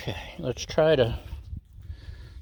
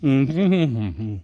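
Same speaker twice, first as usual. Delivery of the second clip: humming with mouth full; chewing while answering; 3 dB louder, much slower than usual. Real, not acted. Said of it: "not to talk with my mouth full".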